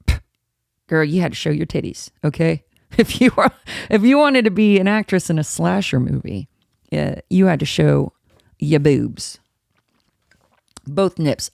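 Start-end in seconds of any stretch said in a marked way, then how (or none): none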